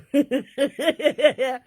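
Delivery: real amused laugh. Real, not acted.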